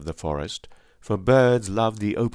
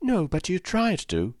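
put-on high-pitched girl answering back; sharp retort; 10 semitones higher than usual. A narrator's reading. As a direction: retort like an indignant child. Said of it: "she retorted".

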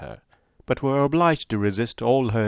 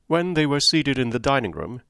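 ordinary talk

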